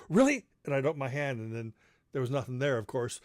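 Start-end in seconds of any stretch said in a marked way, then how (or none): 0.1-0.4 much higher, louder thrilled question; a child who can't believe it; excited kid. Retold or imitated. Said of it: "And I'd go".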